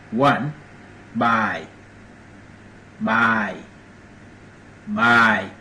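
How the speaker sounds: buy said slow and deliberate, each word spaced; slow Dinka word demo. Shown as a demonstration. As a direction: spell out buy like a teacher, one word at a time. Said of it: "Buy mean home".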